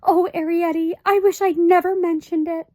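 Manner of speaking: high, anxious mother's voice; fussy high-pitched mother; Homily, fretful mother. A narrator's reading. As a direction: voice a worried little mother. Homily's voice in the story.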